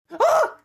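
high, loud startled shriek; shocked scream. Acted. Sees the wrong year on screen.

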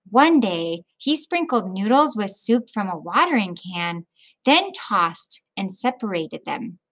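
put-on reading a picture book aloud to children; children's story read-aloud; storybook narration. A narrator's reading. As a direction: tell it gently, like story time.

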